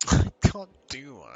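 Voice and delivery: ordinary talk, nothing marked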